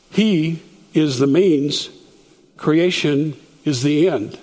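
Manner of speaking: slow, each phrase spaced out; slow and deliberate. Shown as a demonstration. Teacher slowing to show means and end. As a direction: spell it out slowly for the class. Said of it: "here we've got an instrumentation".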